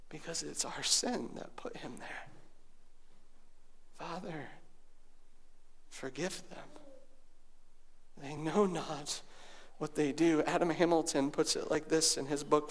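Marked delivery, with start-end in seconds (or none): 0.1-2.3 whisper. Real, not acted. Sermon climax drops to hush. 4.0-10.4 hushed prayer. A narrator's reading. Sermon climax drops to hush.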